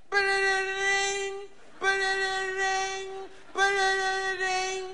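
high slow sung ringing tone; sung phone ring. Retold or imitated. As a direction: croon it like the phone.

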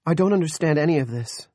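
high, loud bewildered wail; distressed wail. A narrator's reading. Bewildered woman to the men evicting her, wailing. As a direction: wail it out, pitched well up. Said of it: "Carolyn wailed".

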